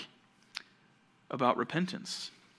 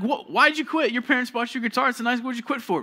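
much higher, louder incredulous outburst; shocked disbelief; 9 semitones higher, 4 dB louder than usual. Retold or imitated. Friend baffled that the guitar was dropped, loud surprised. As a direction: blurt out your disbelief at the quitter.

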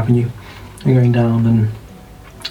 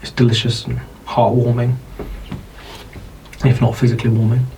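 ordinary talk, nothing marked

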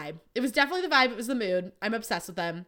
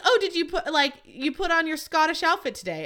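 higher, louder mock dad; imitating teasing dad; 7 semitones higher, 4 dB louder than usual. Dad asking daughter about her outfit, higher mock voice. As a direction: play the dad ribbing her, bouncy and high.

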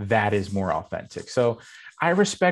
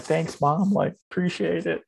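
sheepish thanks; 8 semitones higher than usual. Retold or imitated. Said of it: "And I'm like".

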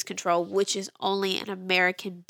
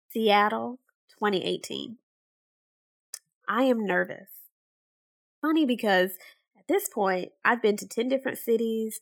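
story narration. Acted. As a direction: read it like a travel memoir. Narrated travel story begins.

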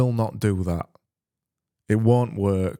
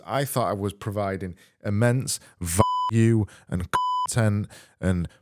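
ordinary talk

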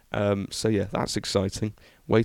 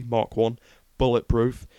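ordinary talk, nothing marked

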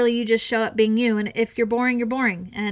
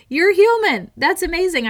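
higher and louder, acting thrilled; mock delight; 7 semitones higher, 6 dB louder than usual. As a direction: gush at the confession.

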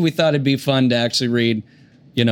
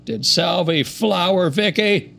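Bill Clinton; 6 semitones higher than usual. Acted. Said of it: "play this role as Bill Clinton".

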